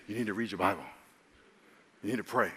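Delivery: low, soft voice in the night; Holy Spirit's voice. Acted. Holy Spirit waking a sleeper at three, low and soft. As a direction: speak it low, a quiet prompting. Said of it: "hear someone say".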